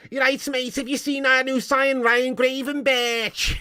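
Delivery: high-pitched mock Scouser, drawing out the name; Scouse accent. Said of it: "imagine the Scousers saying that name. Proper Scouse".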